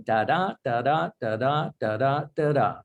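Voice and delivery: "da, da" chanted, weak then strong; iambic beat. Shown as a demonstration. Poet demonstrating iambic pentameter to the host, chanted da-DUM. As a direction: tap out the iambic rhythm. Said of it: "when you write in iambic, you're going".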